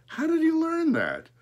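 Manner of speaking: high, loud astonished question; astonished boy's outburst; amazed. Acted. Boy amazed the crow talks.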